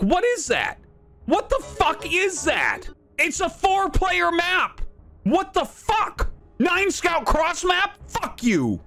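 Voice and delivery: high, slow, disbelieving rant; incredulous outrage. Real, not acted. Streamer ranting about the opponent's nine scout, slow high incredulous. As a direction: stretch it out in total disbelief.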